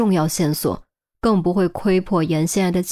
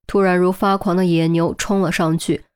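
ordinary delivery, nothing marked

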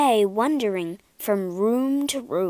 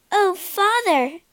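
higher, louder joyful call; joyful cry; 9 semitones higher, 4 dB louder, slower than usual. A narrator's reading. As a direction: cry out in happy relief. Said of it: "she cried".

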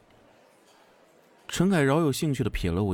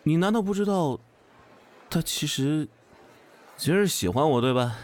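hesitant; 5 semitones higher than usual. A narrator's reading. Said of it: "我有些犹豫地说".

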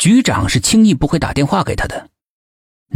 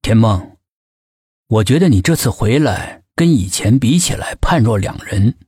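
measured, lower-pitched delivery with pauses; slow, deliberate; 6 semitones lower than usual. A narrator's reading. Team leader confronts Tian Meng after a silence, slow and deliberate. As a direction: say it slowly and low, pausing between phrases. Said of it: "接着缓缓地说道".

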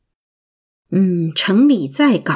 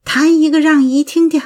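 higher-pitched, eager auntie's request; excited; 6 semitones higher, 4 dB louder than usual. A narrator's reading. Auntie asks the little girl to play piano, excited. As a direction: ask with lifted pitch and lively eagerness. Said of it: "我激动地说".

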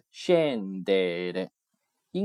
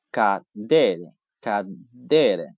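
cadere said slowly with de stressed; 5 dB louder, much slower than usual. Shown as a demonstration. Teacher modelling the mnemonic word slowly. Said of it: "叶落下来".

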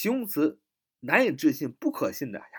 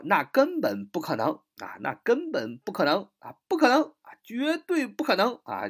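emphatic denial; 7 semitones higher than usual. Shown as a demonstration. Teacher acting out the famous denial, emphatic. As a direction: push up to a high cry of disbelief.

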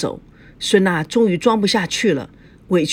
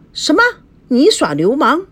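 angry outburst; 7 semitones higher, 4 dB louder than usual. A narrator's reading. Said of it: "孙娜怒了".

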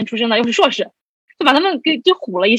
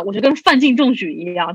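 normal talking voice

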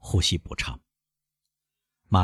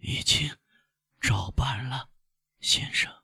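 faint, breathy, halting words; soft dying gasp; gasping for breath; 4 semitones higher, 7 dB quieter, slower than usual. A narrator's reading. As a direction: force the words out between gasps. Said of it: "喘息似乎要撕破他的喉咙".

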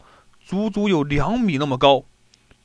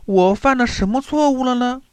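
puzzled question; 8 semitones higher, faster than usual. Acted. Puzzled Jin Jie asks.

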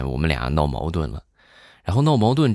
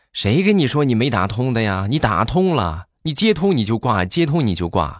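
higher-pitched, indignant mock complaint; exasperated colleague; 6 semitones higher than usual. Colleague tells caller the calls connected, mock exasperated. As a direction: act out a friend fed up with prank-like calls.

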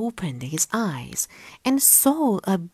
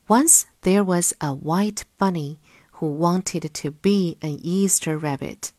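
ordinary delivery

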